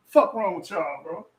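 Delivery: ordinary talk, nothing marked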